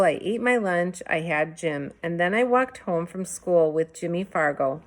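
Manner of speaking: usual speaking voice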